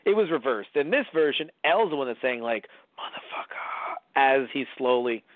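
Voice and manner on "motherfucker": slow, soft whisper; dying whispered curse. Acted. L slowly dying after seeing Light's face, whispering. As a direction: let the curse out as a faint whisper.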